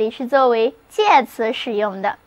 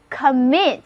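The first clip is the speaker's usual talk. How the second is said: "commit" with the stress on MIT; light first syllable in "commit", stressed MIT; stress on second syllable. Shown as a demonstration. Teacher introduces the next word to learners, stressing MIT in "commit". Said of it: "重音还是放在第二个音节上".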